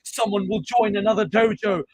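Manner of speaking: high, loud mock gasp of a fan; hammed-up fake shock. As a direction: parody an overexcited fan.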